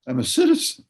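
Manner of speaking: imitating a defiant retort, pitch leaping up; passionate defiance. Activist wife scoffing at threats to her job, passionate defiance. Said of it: "She was passionate. She was committed".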